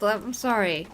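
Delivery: ordinary delivery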